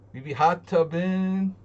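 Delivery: mock hype voice; hammed-up goofy voice. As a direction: play it up as a goofy bro. Sing-song joke about hot tub.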